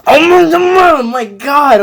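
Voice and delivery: high exasperated cry of oh my god; venting frustration at own rambling; frustrated outburst. Real, not acted. Streamer frustrated at own rambling, cries out high. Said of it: "I'm being angry right now".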